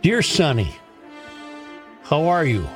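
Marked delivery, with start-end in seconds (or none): none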